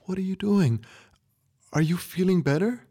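put-on high, anxious young girl; frightened girl. A narrator's reading. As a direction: ask in worried disbelief. Frightened girl questions grandpa.

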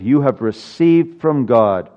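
usual speaking voice

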